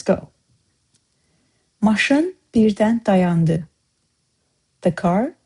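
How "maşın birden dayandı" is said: Maşın birden dayandı said slowly and clearly; slow model sentence. Shown as a demonstration. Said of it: "let's use some of those in some sentences".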